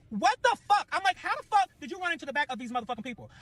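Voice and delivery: high-pitched outraged disbelief; freaking out. Retold or imitated. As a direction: lose it at the driver who just crashed. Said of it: "I'm blooping the fuck out".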